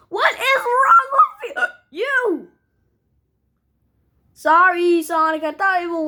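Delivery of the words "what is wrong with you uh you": high, slow, indignant outburst; exasperated scolding. Acted. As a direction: tell them off, drawing it out high. Scolding after failed hiccup cure.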